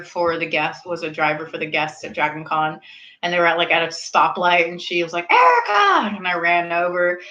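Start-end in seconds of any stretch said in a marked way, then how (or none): 5.3-6.1 high shouted name across the street; calling out. Retold or imitated. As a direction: yell to catch her attention. Friend calling from car.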